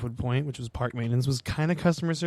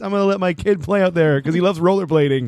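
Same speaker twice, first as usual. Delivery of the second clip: mock clueless parent; 5 semitones higher, 7 dB louder than usual. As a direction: lay the sarcasm on thick. Mocking careless parent.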